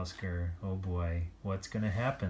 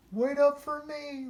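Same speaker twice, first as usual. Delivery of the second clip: very high pleading call; a small dog calling to be waited for; calling after friends. A narrator's reading. Oscar calling after the dogs, very high.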